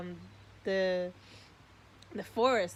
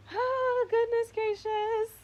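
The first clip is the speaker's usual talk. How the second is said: high, loud and slow emotional exclaim; overwhelmed; 5 dB louder, slower than usual. Real, not acted. Recalling the tree to viewers, high, loud overwhelmed exclaim. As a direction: burst out, moved, high and slow.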